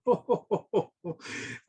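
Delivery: high merry chuckle; happy laugh. Real, not acted. Santa finishing the story for viewers, merry laugh. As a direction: laugh warmly at the end.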